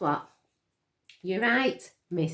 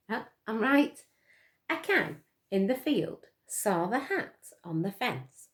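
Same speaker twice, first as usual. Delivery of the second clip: usual speaking voice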